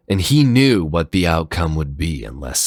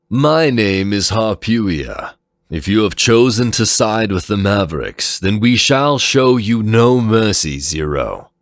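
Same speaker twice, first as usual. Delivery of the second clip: stern leader voice; 5 semitones higher, 5 dB louder than usual. Acted. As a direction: menace him from above, measured. Harpuia, leader, threatens Zero.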